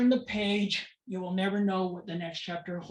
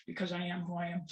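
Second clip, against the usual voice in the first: softer, sober statement; subdued repetition; 6 dB quieter, faster than usual. Real, not acted. Parent repeating the reason rights were taken, subdued repetition. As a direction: let it drop to a quiet close. Same words repeated softly afterwards.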